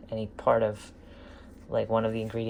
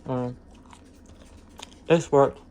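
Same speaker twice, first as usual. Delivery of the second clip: normal talking voice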